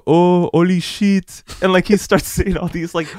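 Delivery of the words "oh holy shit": put-on loud, slow French-accented exclamation. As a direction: mimic a French dad's drawn-out, higher-pitched amazement. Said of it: "saying with his French accent".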